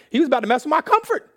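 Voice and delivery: high, loud indignant cry; hammed-up protest at the top of the voice; mock outrage. Traveller tells congregation his comfort was threatened, mock outrage.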